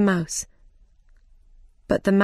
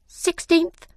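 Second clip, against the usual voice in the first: squeaky mouse voice; 10 semitones higher than usual. Acted.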